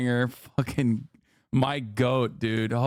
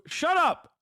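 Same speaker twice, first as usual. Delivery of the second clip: mock yell; 13 semitones higher than usual. Streamer yells at documentary narrator.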